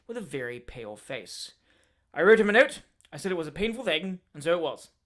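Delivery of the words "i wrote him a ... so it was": louder and louder blustering officer; a hot-tempered man working himself up to a shout; fierce Dowler tirade. Acted. Dowler continues.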